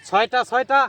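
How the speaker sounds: high, loud shout of a count; calling out. Real, not acted. Fisher announces the catch count, calling out loudly. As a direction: holler the tally across a distance.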